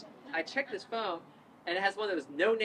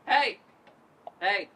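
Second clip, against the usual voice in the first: calling out; 2 semitones higher, 9 dB louder than usual. Retold or imitated. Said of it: "I wave my arms in the air. And I go".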